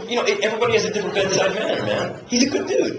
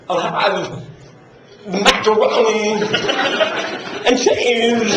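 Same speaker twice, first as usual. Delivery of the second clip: slow, with a long pause before "macaroni" and after it; weird pauses; 3 semitones higher, much slower than usual. Retold or imitated. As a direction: drag out "macaroni" and leave odd gaps around it. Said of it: "it takes a while to get past those weird fucking pauses".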